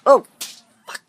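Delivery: ordinary talk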